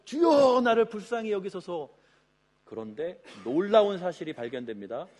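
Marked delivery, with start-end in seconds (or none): 0.1-1.9 loud beggar's plea. Acted. Blind beggar calling to Jesus for mercy, loud plea. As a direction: call out high and loud, easing off after the first word. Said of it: "볼륨감 있게 한 거겠죠".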